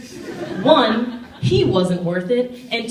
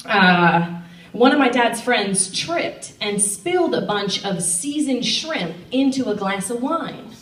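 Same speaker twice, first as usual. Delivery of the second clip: normal talking voice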